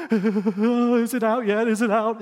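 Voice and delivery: loud, high, slow frantic whine; imitating panicked son. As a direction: mimic a crying child freaking out. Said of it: "he's crying. He's freaking out".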